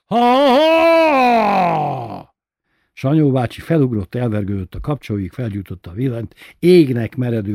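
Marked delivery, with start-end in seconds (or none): 0.1-2.4 long, drawn-out high roar of ha ha; roaring mock laugh. A narrator's reading. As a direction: bellow each ha slowly at full force like a prank. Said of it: "üvöltött egy hatalmasat".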